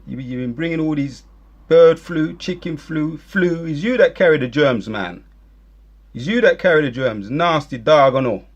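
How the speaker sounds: loud, accusing harangue; angry rant. Acted. Ranting at his enemies over the germs, angry. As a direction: hurl the accusations.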